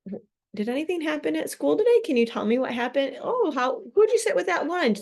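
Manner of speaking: a parent fishing for news after school; eager parent questions. Retold or imitated. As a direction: pepper the kid with upbeat questions.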